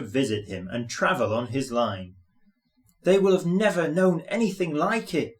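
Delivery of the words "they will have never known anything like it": proud little engine, pitched up; young engine bragging proudly. A narrator's reading. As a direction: boast it brightly. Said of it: "he says proudly".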